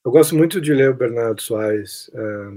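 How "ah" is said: ordinary talk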